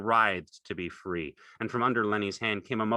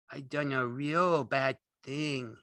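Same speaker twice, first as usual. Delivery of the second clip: slow, high, woeful character voice; dismayed; 8 semitones higher, slower than usual. A narrator's reading. Lenny crouches in the hay, dismayed. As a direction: lament it slowly, pitched high. Lenny fears what he did.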